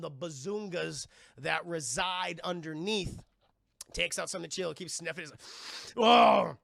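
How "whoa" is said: very loud mock whoa; wowed outburst. Kong sniffs the lady, loud hammed-up wowed bellow. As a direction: bellow it in exaggerated delight.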